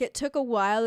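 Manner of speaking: normal talking voice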